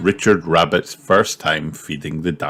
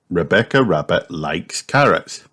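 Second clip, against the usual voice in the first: ordinary delivery, nothing marked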